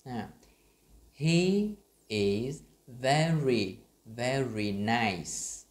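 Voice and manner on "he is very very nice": "very" stretched and punched, slow and louder; slow stressed very. Shown as a demonstration. Teacher drilling very for the class. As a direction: lean hard on each "very". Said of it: "cùng đọc với mình".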